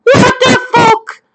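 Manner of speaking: stunned disbelief through a laugh; mother hearing the news, shocked and laughing; shocked outburst. Retold or imitated. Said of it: "And I'm like".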